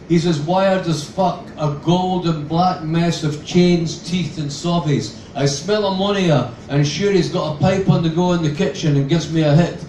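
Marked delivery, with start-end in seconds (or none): none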